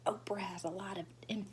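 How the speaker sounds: hushed aside to the teacher's back; very soft. A narrator's reading. Girl ducking in her seat, whispering to her friend very softly. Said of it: "I said very soft".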